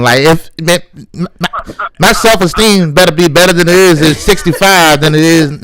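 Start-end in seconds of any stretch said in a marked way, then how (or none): none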